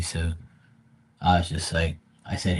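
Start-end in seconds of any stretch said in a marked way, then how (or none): none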